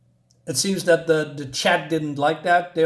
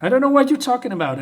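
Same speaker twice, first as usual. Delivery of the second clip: high, loud, rushed denial; mock child's protest, quick and pitched up; protesting girl; 9 semitones higher, 6 dB louder, faster than usual. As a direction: deny it fast and high. Girl's acted protest.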